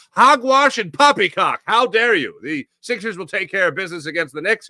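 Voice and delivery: over-the-top huffy dismissal of a worried friend; mock outrage. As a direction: play a scandalised old gent, tongue in cheek. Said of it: "my response was".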